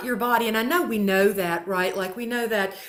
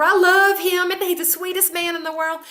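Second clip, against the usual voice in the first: higher, louder, lovestruck gushing; mock gushing lover; 7 semitones higher, 4 dB louder than usual. Imitating a person in a new relationship, mock gushing. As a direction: gush like someone newly in love.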